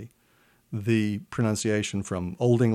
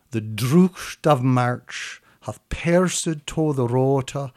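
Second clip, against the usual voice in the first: slow, louder performed reading of Chaucer in Middle English; Middle English recital; 2 semitones higher, 5 dB louder, slower than usual. Shown as a demonstration. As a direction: recite the Canterbury prologue aloud, savoring every vowel. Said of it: "That's beautifully read".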